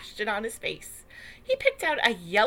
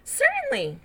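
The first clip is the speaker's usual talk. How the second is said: cheerful; 4 dB louder than usual. Acted. Hatch eagerly agrees.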